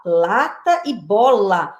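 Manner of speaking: LATA and BOLA drawn out slowly, each set apart; slow deliberate contrast. Shown as a demonstration. Teacher comparing two picture words for a child, slow deliberate contrast. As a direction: say Lata e bola at half pace, weighing each word.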